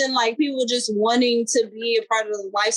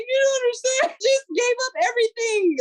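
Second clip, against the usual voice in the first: much higher, wailing mock-crying plea; imitating crying; 13 semitones higher than usual. Artist retelling her tearful call to a buyer, high mock wail. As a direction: replay the teary phone call, pitched way up. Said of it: "I was on the phone crying with her".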